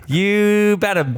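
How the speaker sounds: "You bet 'em" drawn out slow and high. Real, not acted. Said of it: "you bet 'em".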